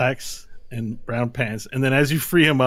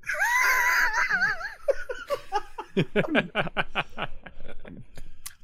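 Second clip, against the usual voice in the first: shrieking burst of laughter. Real, not acted. Big laugh at the table.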